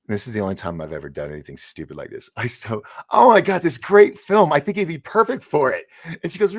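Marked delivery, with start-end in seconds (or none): 3.1-5.8 high, loud, overeager pitch; mock-enthusiastic sales pitch to impress; gushing pitch. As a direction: blurt it out big and eager, stretching the words. Acted gushing pitch to actress.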